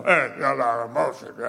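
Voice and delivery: low, garbled mock grumble; hammed-up low griping voice; complaining emailer. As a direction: imitate a grumbling critic, words mushed together. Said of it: "I always get an email".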